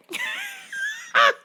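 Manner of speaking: very high squealing laugh; shriek laugh. Real, not acted. Cracking up.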